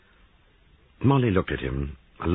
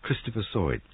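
usual speaking voice

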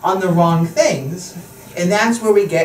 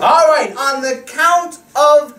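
slow, drawn-out magic countdown; kids party magician; 5 semitones higher, slower than usual. Acted. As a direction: stretch it out like a stage magician. Magician builds suspense for kids.

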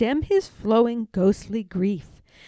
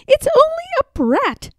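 put-on higher, scornful child voice; little girl Madeline; 4 dB louder than usual. A narrator's reading. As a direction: dismiss it like a bossy girl.

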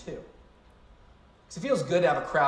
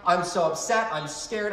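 ordinary delivery

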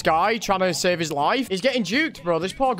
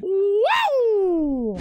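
high, long "woo"; excited whoop; 11 semitones higher than usual. Real, not acted. Player cheering at the game, excited whoop. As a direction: let out a drawn-out high whoop.

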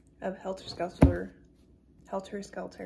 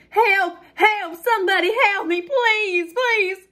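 high, loud desperate cries; hollering for help; 12 semitones higher, 6 dB louder than usual. Acted. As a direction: scream for rescue in short pleading bursts. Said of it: "all that hollering".

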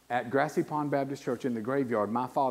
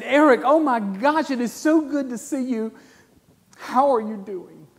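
joyful greeting; 11 semitones higher, 11 dB louder than usual. Retold or imitated. Deacons joyfully greeting Eric.

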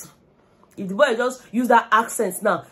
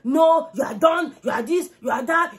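mock shouting; 8 semitones higher than usual. Quoting Wani scolding in garden.

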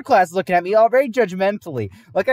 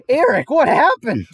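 higher, louder alarmed question; shocked friend; 9 semitones higher, 7 dB louder than usual. Retold or imitated. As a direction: friend stunned by the smell. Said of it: "Garrett looks at me and goes".

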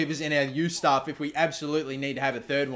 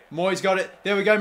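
normal talking voice

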